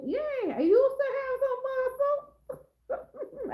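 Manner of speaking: high, slow put-on squeal; high, slow silly voice; squeaky mock boast. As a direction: squeal it out like a joke about your old self. Mock boast about past muscles.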